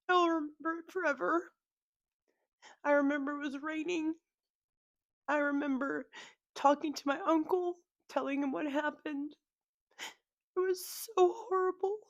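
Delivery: high, halting tearful voice; real tears, stopping between phrases; crying. Real, not acted. Daughter recalling the day her mom died, crying. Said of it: "sorry for crying like this".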